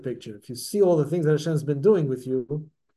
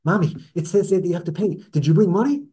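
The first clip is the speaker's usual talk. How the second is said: put-on mild anxious kid, a little louder; lightly voiced worried child checking with mom; nervous child; 4 semitones higher, 4 dB louder than usual. Nervous child running over to mommy at the park entrance, anxious. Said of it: "all nervously and says".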